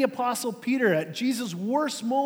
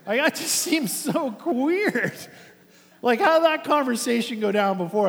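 incredulous; 4 semitones higher, 5 dB louder than usual. Real, not acted. Pastor to congregation, puzzled, incredulous disbelief.

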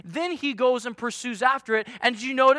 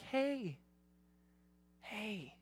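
tender whisper; 4 semitones lower, 15 dB quieter than usual. Retold or imitated. Shepherd to the tired lost sheep, tender whisper. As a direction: hush like a parent to a tired child. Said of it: "you get down here and you're like".

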